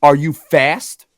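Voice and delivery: slow, menacing question; a hint of a tough guy sizing him up; mock tough guy. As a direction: drunk stranger at the window, slow mock menace. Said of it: "And he's like".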